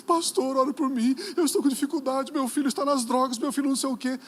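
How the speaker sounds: high, loud mock plea; imitated pleading mother. Church sister asks pastor to pray for her son, imitated high plea. Acts out distressed mother asking prayer.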